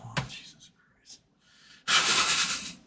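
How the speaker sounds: breathy, near-silent groan; exasperated at the pun; whispered groan; groaned under breath. Real, not acted. Groaning at a bad joke.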